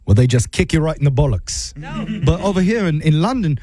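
hammed-up British customer-care agent; bad British accent. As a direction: put on a terrible British accent. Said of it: "do my absolute worst British accent".

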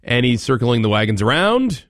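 normal talking voice

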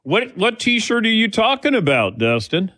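high-pitched put-on Southern accent. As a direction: ask it in a mock Southern accent. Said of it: "did a Southern accent there".

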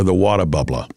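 put-on light Boston accent, r's dropped; New England accent. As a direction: say it like a Massachusetts native, "watah bubblah". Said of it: "New England accent over the Jersey accent".

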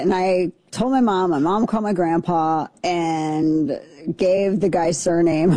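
usual speaking voice

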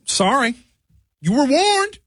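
high, sing-song fake warning; mock menacing. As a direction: tease with a pretend threat. Mock menace after ripping.

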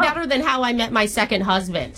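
hammed-up Long Island-Boston-Minnesota mom accent; horrible jumbled accent. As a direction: play a chatty older girlfriend in a bad regional accent mash-up. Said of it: "doing a horrible accent".

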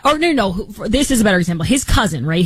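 ordinary delivery

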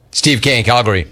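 normal talking voice

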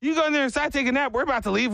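high, chummy host impression; imitated overfriendly offer. Father-in-law offering worker a nap spot, high eager voice.

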